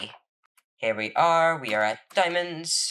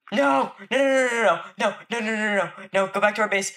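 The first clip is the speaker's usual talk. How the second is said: raging gamer; 9 semitones higher, faster than usual. Real, not acted. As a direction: panic as everything falls apart. Bed under attack, panicking.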